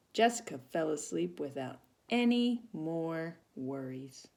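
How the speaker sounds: plain reading voice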